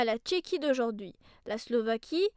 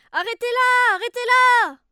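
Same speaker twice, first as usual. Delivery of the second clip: high loud shout; 9 semitones higher, 13 dB louder than usual. Acted. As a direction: yell to stop her. Waiter shouting after Mimi.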